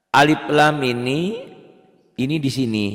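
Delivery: grab the class's attention; emphatic call. Real, not acted. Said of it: "teacher calls for attention". (seruan tegas ke murid)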